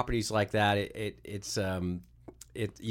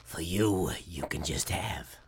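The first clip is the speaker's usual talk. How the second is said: whisper; 5 semitones lower, slower than usual. Acted. Creepy gift of boogery creatures.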